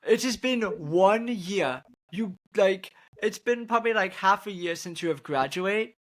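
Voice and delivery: worked up and incredulous, pitch climbing; annoyed. Real, not acted. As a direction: protest in mock outrage, punching key words. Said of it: "This annoyed me so bad".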